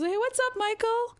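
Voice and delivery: lightly voiced, high-pitched hello; friend's casual greeting. Friend greets the teller's dad.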